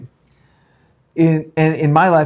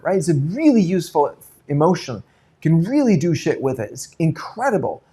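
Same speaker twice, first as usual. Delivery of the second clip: ordinary talk, nothing marked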